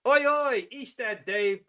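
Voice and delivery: high, loud cheeky Oi oi; cockney greeting call. Real, not acted. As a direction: call it out like an East End mate. Calls out to a viewer.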